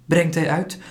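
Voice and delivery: ordinary delivery, nothing marked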